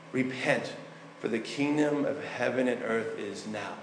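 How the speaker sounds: usual speaking voice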